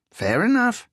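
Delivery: high, whiny English voice; whiny Englishman. A narrator's reading. English thief quizzes Riso in a high whiny voice. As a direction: push it up into a whine.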